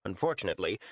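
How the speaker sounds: ordinary delivery, nothing marked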